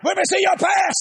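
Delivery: a trader nicknamed Satan shouting an objection; mock objection. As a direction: call it out across the pit. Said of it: "retold shout".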